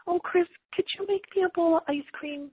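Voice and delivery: imitating mom's high, sweet request; sweet asking. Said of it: "Did you hear the way your voice just said that?".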